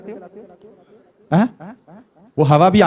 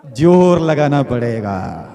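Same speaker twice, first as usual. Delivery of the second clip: "जोर लगाना पड़ेगा" slow and lower, vowels stretched; drawn-out emphasis; 4 semitones lower, slower than usual. Shown as a demonstration. Preacher models the effort needed. ("जोर लगाना पड़ेगा" धीरे, खींचकर, नीचे सुर में)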